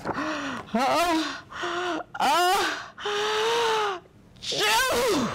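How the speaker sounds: rising sneeze ah-ah-choo; building sneeze. Acted.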